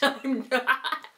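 ordinary talk

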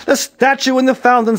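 loud, high, impatient explanation; scolding. A narrator's reading. Police chief setting Aaron straight, scolding.

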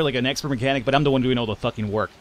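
ordinary talk, nothing marked

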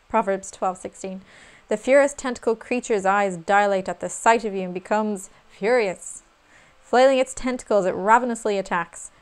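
theatrical, announcing the boss's attack; dramatic card reading. Acted. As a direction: read it like a villain's entrance. Reads boss card dramatically.

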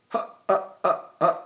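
short, soft checked "uh" sounds; stopped breath. Shown as a demonstration. Teacher showing where the breath stops, short quiet grunts. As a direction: cut each little grunt off at once. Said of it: "And I stop it right here".

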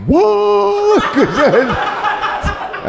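mock shock at the answer; shocked what. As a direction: gasp it out in disbelief. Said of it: "I'm like".

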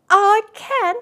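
high, loud eager claim; insist, eager to prove it; insistent little rabbit. A narrator's reading. Little rabbit insisting to the wild rabbit, high and loud. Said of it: "said the little rabbit".

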